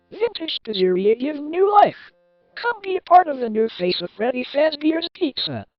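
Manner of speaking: high, sing-out promotional pitch; advert read. Acted. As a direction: sell it like a grand reopening ad. Reads pizzeria's newspaper advert.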